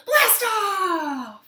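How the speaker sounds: high, loud, drawn-out cheer; launch shout. Real, not acted. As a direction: shout it like a rocket taking off.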